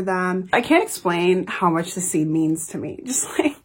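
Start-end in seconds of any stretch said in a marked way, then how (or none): 3.1-3.6 whispered. Real, not acted. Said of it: "I'm gonna cry again".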